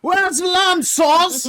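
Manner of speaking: very high, loud, drawn-out yell; mock chef shout. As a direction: bellow it like a frantic TV chef. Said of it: "and then it's Hell's Kitchen".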